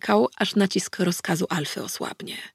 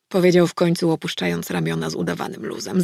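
plain reading voice

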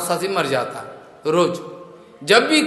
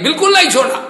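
a sudden much higher, louder no; indignant shout; 10 semitones higher, 5 dB louder, much faster than usual. Acted. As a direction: throw it out loudly, disgusted. Shiva pushes the impure guest away.

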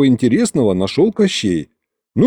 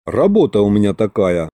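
ordinary delivery

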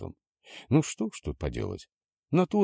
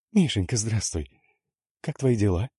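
high, sugary phone voice; sugary sing-song; 4 semitones higher, 3 dB louder than usual. A narrator's reading. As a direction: sing it out, syrupy sweet. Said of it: "пропел в трубке слащавый". (слащаво-певучий голос тётки)

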